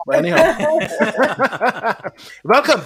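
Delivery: laughing welcome. Real, not acted. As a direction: still chuckling as you welcome. Host laughing while welcoming.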